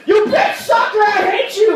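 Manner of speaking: very high, hammed-up furious yelling; enraged dad. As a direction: imitate a father sputtering botched curses. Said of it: "He would get so mad".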